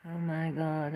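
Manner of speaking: ordinary talk, nothing marked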